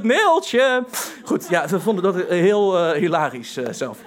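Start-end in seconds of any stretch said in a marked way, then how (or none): 0.0-0.8 high playful call of the name; mock greeting call. Friends greeting each other with the stage call, playful. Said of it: "dan was het".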